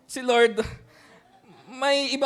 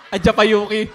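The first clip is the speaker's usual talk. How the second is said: louder, words broken by laughter; laughing; 6 dB louder than usual. Real, not acted. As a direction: laugh at your own slip. Laughing at embarrassing word. (natatawang bulalas)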